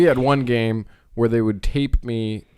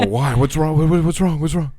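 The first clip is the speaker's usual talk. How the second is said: higher, louder worried questions; panicked kid asking what's wrong; little brother alarmed about his eyes; mock panic; 7 semitones higher, 5 dB louder than usual. Young Andrew panicking.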